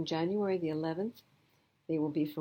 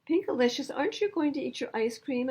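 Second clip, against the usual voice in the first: higher, concerned mother; Mommy voice; 8 semitones higher, 5 dB louder than usual. Acted. Mother asks daughter about ice cream, higher and concerned. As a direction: gently ask a child why she isn't eating.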